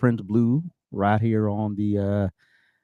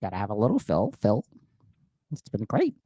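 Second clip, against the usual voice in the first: customer service voice; 4 semitones lower, 5 dB quieter than usual. Retold or imitated. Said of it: "My customer service voice".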